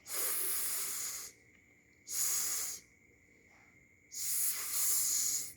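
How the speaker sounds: "s" as a long soft hiss; unvoiced S. Shown as a demonstration. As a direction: hold "s" as pure air, no voice.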